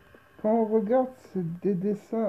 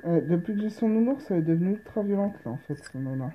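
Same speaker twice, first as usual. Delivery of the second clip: ordinary delivery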